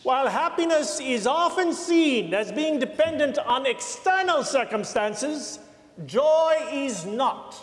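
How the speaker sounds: put-on high, slow South African accent; lightly voiced South African accent. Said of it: "It's my mediocre South African accent".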